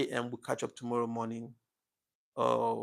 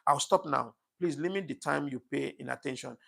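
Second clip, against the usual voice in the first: ordinary talk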